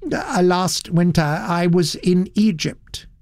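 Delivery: mildly apologetic society gentleman; Satterthwaite explaining himself. Acted. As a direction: explain politely, with a touch of apology.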